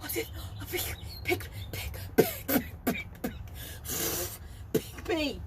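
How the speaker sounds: whispered, stammered pick pick pick; breathless pleading. Retold or imitated. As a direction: choke on the words with eagerness. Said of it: "I couldn't even get the words out".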